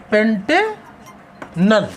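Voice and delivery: Pantanal very drawn out, long pause before नल; slow dictation. Shown as a demonstration. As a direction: split Pantanal into two slow parts, पेंटे, then नल. Teacher repeats name very slowly.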